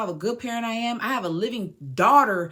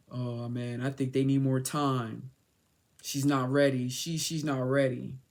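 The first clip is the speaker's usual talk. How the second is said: low, slow imitation of someone hedging; low retold verdict, unhurried; mock deliberation; 8 semitones lower than usual. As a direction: drop low and drag it out, imitating a doubter. Voices the other side in court.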